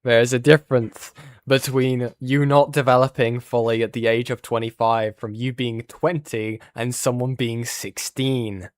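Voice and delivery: loud heated objection. Real, not acted. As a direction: shout the point over them. Heated objection, much louder.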